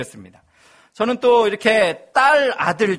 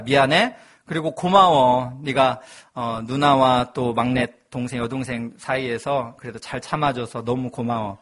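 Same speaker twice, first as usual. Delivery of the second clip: tender letter to son; 7 semitones lower than usual. Retold or imitated. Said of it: "시키니까 했습니다".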